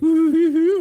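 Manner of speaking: coy flirty giggly coo; coyly. Retold or imitated. Mocking the women in the car, high coy coo. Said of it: "coyly, like".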